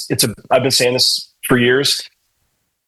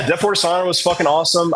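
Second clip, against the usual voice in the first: normal talking voice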